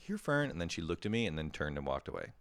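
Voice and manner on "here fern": high, coaxing call to a dog. Retold or imitated. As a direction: sweetly coax the dog over, pitched up. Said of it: "I was like".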